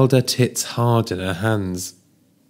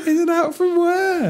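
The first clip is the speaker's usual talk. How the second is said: laughing. Real, not acted. Incredulous laughing question.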